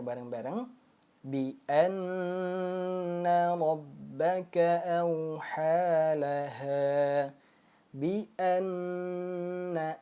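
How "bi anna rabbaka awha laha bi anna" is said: slow melodic tajwid chant; Quran recitation. Shown as a demonstration. Teacher chanting the verse for the class to follow. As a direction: recite bi anna rabbaka slowly and melodically.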